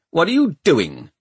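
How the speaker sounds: indignant snap; snapped challenge. A narrator's reading. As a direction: snap it like an official catching trespassers. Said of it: "he snapped".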